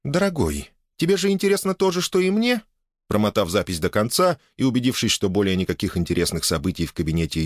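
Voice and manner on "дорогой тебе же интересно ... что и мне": high-pitched playful wife voice; coaxing wife. A narrator's reading. Wife asking husband to share her curiosity, high coaxing voice. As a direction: coax him in a raised, lilting feminine voice.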